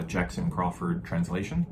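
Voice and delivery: ordinary delivery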